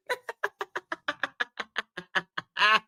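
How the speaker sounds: long, soft wheezing laugh; wheezy laugh. Real, not acted. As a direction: laugh until the breath runs out. Laughs at herself.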